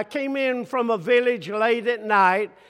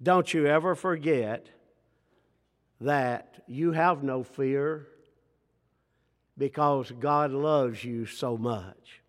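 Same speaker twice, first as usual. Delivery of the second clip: soft reassuring close; 5 semitones lower, 4 dB quieter than usual. Acted. Quiet reassurance after climax.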